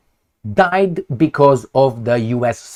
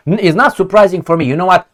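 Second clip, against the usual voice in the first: ordinary talk, nothing marked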